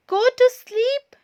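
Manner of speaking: very high and loud startled question; loud high shocked shout. A narrator's reading. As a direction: shout it in disbelief. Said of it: "shouted Todd".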